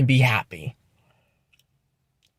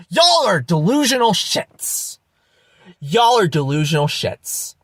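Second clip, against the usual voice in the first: angry outburst; 2 semitones higher than usual. Real, not acted. Vlogger berating deluded believers, angry outburst. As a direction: lash out, pitch leaping on Y'all. Exasperated repeated insult to viewers.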